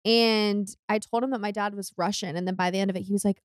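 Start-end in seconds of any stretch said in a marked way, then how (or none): none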